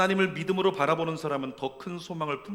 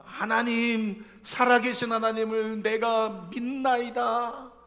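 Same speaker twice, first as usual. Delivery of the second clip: high, louder, slow declaration of faith; breakthrough confession; 7 semitones higher, 7 dB louder, much slower than usual. Retold or imitated. Suffering believer finally confessing God, slow fervent declaration. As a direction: let the faith burst out.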